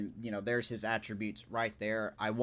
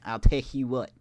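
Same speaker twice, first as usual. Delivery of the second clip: mock southern accent; 4 dB louder than usual. Streamer joking to chat in a put-on Southern accent, louder. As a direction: play a loud country good ol' boy. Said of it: "I'm gonna talk like this".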